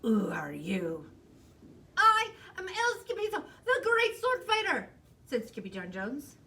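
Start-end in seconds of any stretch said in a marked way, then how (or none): 0.0-1.1 slow, low, loud growl; growling chihuahua leader. A narrator's reading. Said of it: "growled Don Diego". 2.0-4.9 loud, high proud proclamation; swaggering little swordsman; boastful kitten hero. A narrator's reading.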